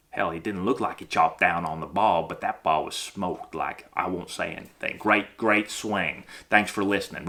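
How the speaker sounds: low, soft, grudging aside; fooled coach, muttering. Acted. Fooled coach backing down after a smoked ball, muttering.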